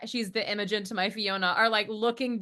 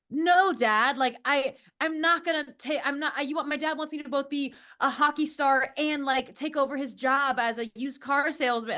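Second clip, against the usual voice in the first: whiny son to dad; 5 semitones higher than usual. Retold or imitated. Retelling the musical, a son protests to his dad in a lightly higher pleading voice. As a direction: plead with Dad, a bit over the top. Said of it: "comes up and is like".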